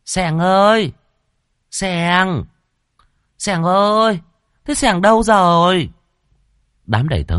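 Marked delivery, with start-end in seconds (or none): 0.0-5.9 much higher and louder, shrill calling. A narrator's reading. Second wife calling her missing dog, shrill and high. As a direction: holler for the lost dog, shrill. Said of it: "Bà nhíu mày rồi lại gọi".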